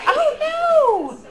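loud, very high, drawn-out 'oh no'; mock-polite protest. Shown as a demonstration. Teacher correcting the babysitter label, playful high protest. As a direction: sing out a hammed-up correction. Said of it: "You're gonna just politely say".

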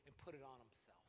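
ordinary talk, nothing marked

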